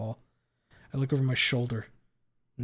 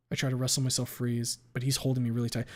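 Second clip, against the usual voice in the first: ordinary delivery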